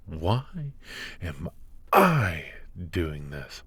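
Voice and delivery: echoed question with "I" leaping up, then dropping away; stress on I. Acted. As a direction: throw the question back, leaning hard on "I". Echoes the question put to them.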